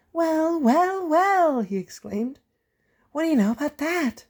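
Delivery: very high, amused engine; gloating Percy. Acted. Percy teasing about Thomas, gloating. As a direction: savour the joke.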